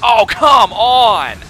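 loud, drawn-out frustrated yell; exasperated shout. Real, not acted. Player protesting to the game, exasperated shout. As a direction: stretch each word in a loud protest.

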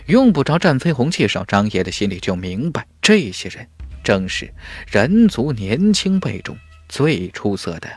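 plain reading voice

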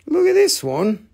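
high-pitched, rising invitation; high show-off. Pretend. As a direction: pipe it up with a lift at the end. Shows a piece in two voices.

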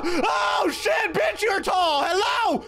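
very high, squealing tirade; frantic high-pitched shouting. Real, not acted. Player telling the tall ghost to move, frantic high shrieking bluster. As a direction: shout down a monster in a panicked squeal.

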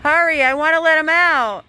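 loud, high, urgent call; impatient call. Real, not acted. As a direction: hurry someone along from across the yard. Impatient call across the yard.

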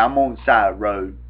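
usual speaking voice

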